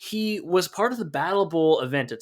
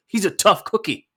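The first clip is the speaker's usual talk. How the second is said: imitating a wrestler's deadpan line, pitched up; hammed-up deadpan tough-guy quote; deadpan impression; 9 semitones higher, 4 dB louder than usual. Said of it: "very deadpan goes".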